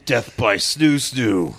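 very slow, drawn-out deadpan. Acted. Joking about the nine women, slow deadpan. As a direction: stretch each word out solemnly.

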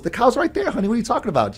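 high-pitched mock exasperated mother; wife on the road trip puzzled why daughter can't see cows, high mock disbelief; imitating his wife. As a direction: play the wife pointing, baffled. Wife in the retold story.

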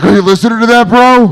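mock bro voice. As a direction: imitate a bro marvelling at the noise. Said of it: "Andrew's like".

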